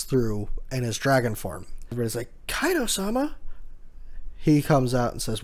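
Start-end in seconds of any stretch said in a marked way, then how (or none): 2.5-3.4 high-pitched startled question; mock astonished crowd voice, pitched way up. Reviewer voices the crowd seeing Kaido pop through, mock startled.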